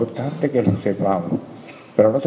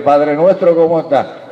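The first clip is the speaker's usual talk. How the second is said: mock greeting; 3 semitones higher, 6 dB louder than usual. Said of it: "Yo no puedo ir a la casa".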